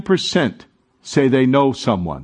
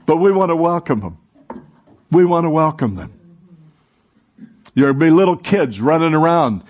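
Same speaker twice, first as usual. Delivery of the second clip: ordinary talk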